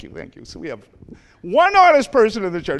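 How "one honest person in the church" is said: high, loud mock surprise; playful jab at the congregation; teasing. Real, not acted. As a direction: tease the crowd about lateness. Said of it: "No, I'm teasing".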